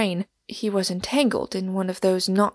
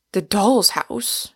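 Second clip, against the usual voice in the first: slow, louder outburst of disbelief; vehement cry; 4 dB louder, slower than usual. A narrator's reading. Englishman protesting the narrator's Ibsen reference, vehement and astonished. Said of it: "he cried vehemently".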